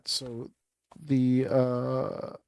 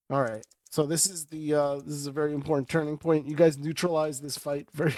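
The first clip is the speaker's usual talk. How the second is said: ordinary delivery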